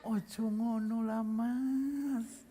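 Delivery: soft, slow, drawn-out mild plea; silky-gentle Javanese. Acted. Mimicking a Javanese scolding a pickpocket, silky-gentle and slow. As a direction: plead ever so politely, stretching the last word. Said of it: "lebih lembut daripada kain sutra".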